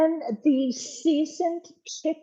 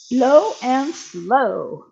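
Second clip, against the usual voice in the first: "Low and slow" drawn out, loud and very slow; slow, emphatic; 5 dB louder, much slower than usual. Shown as a demonstration. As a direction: stretch out "Low and slow" like a slogan. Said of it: "Remember, low and slow".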